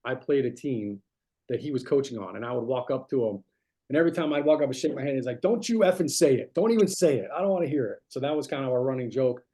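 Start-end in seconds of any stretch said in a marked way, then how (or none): 5.4-8.0 1 mock annoyed coach. Coach's running joke, mock annoyed.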